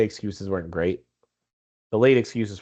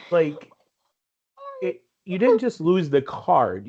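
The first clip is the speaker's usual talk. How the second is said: ordinary talk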